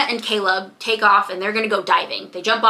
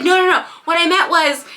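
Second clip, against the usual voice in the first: higher, louder backpedalling; flustered; 8 semitones higher, 4 dB louder than usual. Acted. Girl backpedalling to Jake, high and flustered. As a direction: rush to take it back, flustered. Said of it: "she's getting all flustered".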